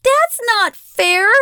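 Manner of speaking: high, loud, drawn-out protest; shout. A narrator's reading. Abigail protests the threat to grandfather, shouting. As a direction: shout out the injustice. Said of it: "Abigail shouts".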